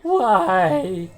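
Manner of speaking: loud high cry of disbelief; exasperated why. Real, not acted. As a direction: wail it out in exasperation. Reacting to bad luck.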